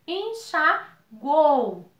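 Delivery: "Enxaguou" bem lento, en-xa-guou, com o "uou" junto; palavra soletrada em sílabas. Shown as a demonstration. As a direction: separe "Enxaguou" devagar, sem partir o "uou". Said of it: "Esse uou, que vai ficar numa mesma sílaba". ("Enxaguou" silabado para a turma)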